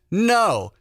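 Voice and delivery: high, loud mock refusal; joking no. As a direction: snap a fake no for the joke. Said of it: "And we said".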